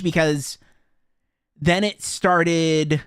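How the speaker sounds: ordinary talk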